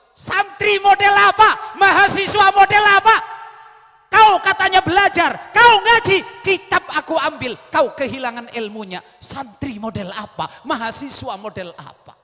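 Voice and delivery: high, loud contemptuous shouting that fades; robber's scorn retold. Preacher repeats the robber chief's scorn, shouting, then fading. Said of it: "tadi bahasanya ketuanya itu".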